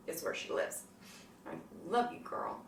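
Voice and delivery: tender whispered confession. Real, not acted. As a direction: whisper it from the heart. Pointing to heart, tender.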